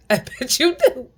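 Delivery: high-pitched, breaking into real laughter; gloating laughter. Real, not acted. Retelling the comeback to energy drainers, gloating laughter. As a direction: laugh right through the taunt. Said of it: "I've been laughing in the motherfucker face".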